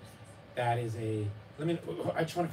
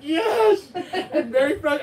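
put-on kid's frustrated whine; 13 dB louder, slower than usual. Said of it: "Raffi would be like".

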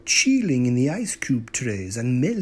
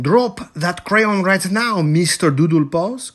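stern mama's order, higher and louder; loud, firm scolding order; commanding; 4 semitones higher, 6 dB louder than usual. A narrator's reading. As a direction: command it like a fed-up mother. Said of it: "commanded Mama Junebug Jones".

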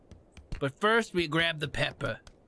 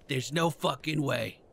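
ordinary delivery

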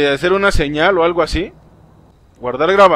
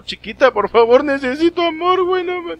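whiny begging climbing much higher; mock desperate pleading; 9 semitones higher than usual. Player begging a girl in the game for love, hammed-up plea. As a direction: beg for love, pitch rising into a squeak.

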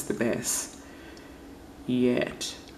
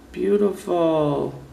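slow, louder, higher swoon; gushing admiration; 4 semitones higher, 5 dB louder, slower than usual. Real, not acted. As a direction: draw the word out in delight. Gushing over her favourite polish.

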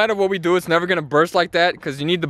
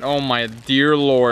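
mock awe; 7 semitones lower, much slower than usual. Awed at pile of tablets.

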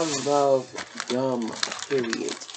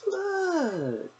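delighted. Real, not acted. Showing off new plates.

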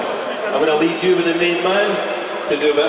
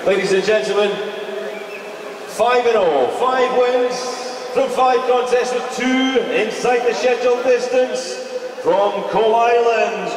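ring announcer introduction; 5 semitones higher than usual. Acted. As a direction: build the boxer's intro like a fight-night MC.

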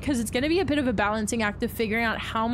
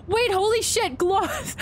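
high, amazed outburst; startled delight; 9 semitones higher, slower than usual. Real, not acted. Streamer surprised by a viewer's sub, high startled outburst. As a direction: blurt it out high in shock.

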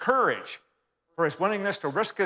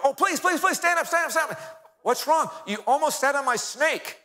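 shrill alarm over a pet snake; panicked plea; 9 semitones higher than usual. Retold or imitated. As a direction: beg him to stand up, fast and desperate. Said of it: "Carver saw this man sit down and go".

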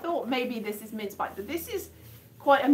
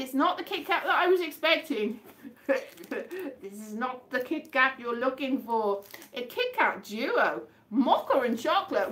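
ordinary talk

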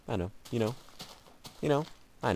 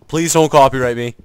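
loud, higher-pitched playful plea; mock pleading shout; 6 semitones higher, 18 dB louder than usual. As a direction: cry it out as an exaggerated plea. Mock plea to copyright claimants.

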